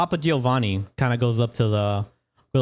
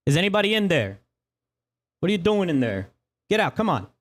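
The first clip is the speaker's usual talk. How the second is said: raised, calling into the cart; npc calling out; 6 semitones higher than usual. Acted. Guard calling into the cart, suspicious and raised. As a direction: shout into the cart to flush them out.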